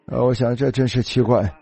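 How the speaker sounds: ordinary talk, nothing marked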